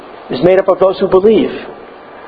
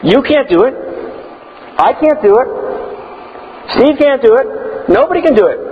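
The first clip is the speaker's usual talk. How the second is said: high stressed can't each time; emphatic; 8 semitones higher than usual. Real, not acted. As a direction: hammer the word can't. Preaching emphatic list.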